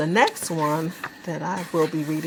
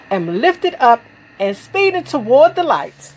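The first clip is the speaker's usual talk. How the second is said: higher, emphatic poem reading; poetry recitation; 6 semitones higher, 5 dB louder than usual. Acted. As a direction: recite with big rising and falling swells.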